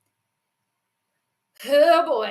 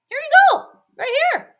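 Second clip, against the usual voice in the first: very high, louder offer; mock coaxing; 7 dB louder, faster than usual. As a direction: coax in a high pretend voice, as if offering a treat. Offers Kirk a cookie, playacting.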